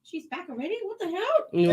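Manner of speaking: high, loud disbelief; indignant surprise. Retold or imitated. Friend whose show is interrupted by early return, indignant surprise. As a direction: exclaim in put-out astonishment. Retold shock at quick return.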